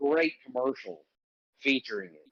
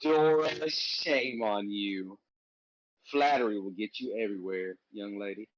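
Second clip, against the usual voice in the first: mock New York accent; 5 semitones lower, 3 dB quieter than usual. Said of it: "New Yorker with that accent".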